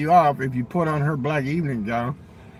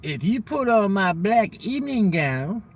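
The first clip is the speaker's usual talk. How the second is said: her voice; 5 semitones higher, slower than usual. Retold or imitated. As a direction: mimic her slow, sultry offer. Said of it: "She told me".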